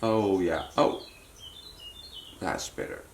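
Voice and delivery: usual speaking voice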